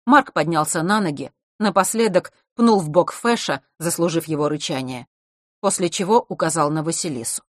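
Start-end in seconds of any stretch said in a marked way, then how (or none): none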